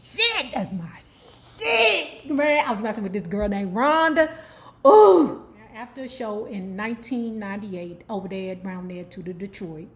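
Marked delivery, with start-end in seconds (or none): 0.0-2.3 high, drawn-out whoop of approval; excited fan. Real, not acted. Fan reacting to own favorite song, excited. 4.9-5.4 high, loud "ooh"; loud whoop. Real, not acted. Comedian recalling a girl named Rhonda, loud whoop. Exclaims over memory of Rhonda.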